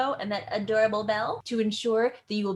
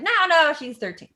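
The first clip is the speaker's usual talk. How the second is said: high, loud burst of protest, then easing; mock-indignant cry on the no's; Nurse; 9 semitones higher, 10 dB louder than usual. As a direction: blurt a hammed-up objection. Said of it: "And the nurse is like".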